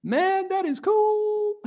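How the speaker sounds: very high-pitched mock astonishment; amazed onlookers. Impressed people react to the young coder, hammed-up amazement. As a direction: squeal in awe, stretching the last words. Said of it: "People are gonna be like".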